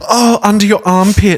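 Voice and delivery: real horror. Real, not acted. Listener hearing about a shot under the armpit, horrified. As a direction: wince aloud at the gory detail.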